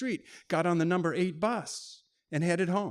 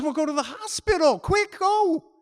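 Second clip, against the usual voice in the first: much higher, louder urgent push; urgent panic; 11 semitones higher, 8 dB louder than usual. Retold or imitated. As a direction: hurry him out the door. Clarissa panicking.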